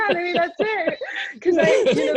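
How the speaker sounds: high, loud, laughing agreement; real amused laughter while talking. Real, not acted. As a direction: laugh through the words, pitch jumping up. Amused agreement about her accent.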